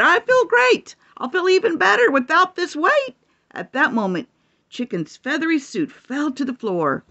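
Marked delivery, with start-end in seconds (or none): 0.0-3.2 chicken voice. Acted. Chicken telling the others in a hen voice. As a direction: chirp it out like a happy hen. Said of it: "Chicken replied".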